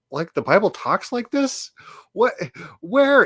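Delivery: high-pitched, baffled disbelief; incredulous. Real, not acted. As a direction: question it as if it were absurd. Mocking the opponent's claim in commentary.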